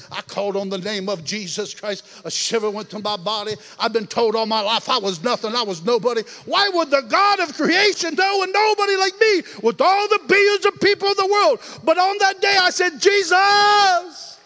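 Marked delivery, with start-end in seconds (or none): 3.8-13.0 rising to a loud, high shouted climax; preaching at full force. Acted. Preacher building to a shout before the congregation. 13.0-14.4 a loud, high cry of the name; shouted name. Retold or imitated. Preacher reliving calling on Jesus, shouting.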